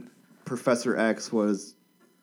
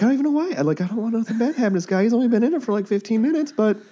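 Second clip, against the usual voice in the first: excited; 11 semitones higher, much faster than usual. Retold or imitated. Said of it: "you're like".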